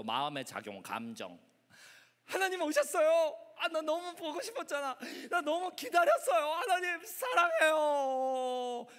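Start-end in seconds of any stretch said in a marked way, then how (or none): 2.2-8.9 high, loud adoring welcome; overjoyed emotion. Acted. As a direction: gush with longing, stretching the last words out. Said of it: "감정도 있었어요".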